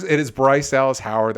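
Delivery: ordinary talk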